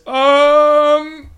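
high, loud drawn-out guard um; charmed guard hesitating before answering; hesitant guard. Acted. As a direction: stall like a dim guard.

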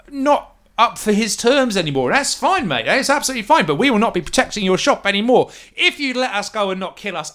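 nervous thug caught at knifepoint; collared ruffian pleading with the captor. Acted. Ruffian bargains.

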